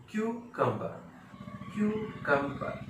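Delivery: क्यूकम्बर slowly with the final r dropped; British pronunciation. Shown as a demonstration. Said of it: "ज़्यादा stress करके नहीं".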